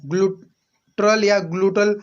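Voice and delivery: ग्लूट्रल and ग्लूटल said slowly as two variants; slow pronunciation demo. Shown as a demonstration. Teacher showing students two ways to say it, slowly. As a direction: slowly give ग्लूट्रल then ग्लूटल side by side. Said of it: "हम लोग कहते हैं।".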